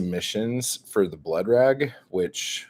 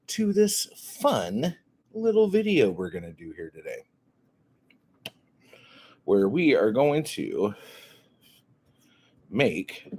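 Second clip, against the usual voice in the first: ordinary delivery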